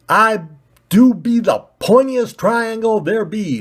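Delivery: a proud bumpkin boast; odd country voice. Acted. Pointy ball again.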